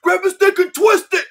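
can barely get it out for laughing; laughing through words. Real, not acted. Repeating the crude quote to cohost, laughing hard. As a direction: repeat it high and loud, laughing.

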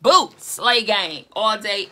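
ordinary talk

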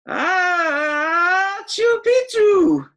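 a loud high sneeze with words in it; half-sneezed shout; sneezed password. Acted. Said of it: "half sneezed, half spoke".

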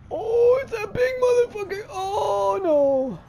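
very high, loud scared wail; shrieking panic as the monster appears; terrified cry. Real, not acted. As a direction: cry out in real terror.

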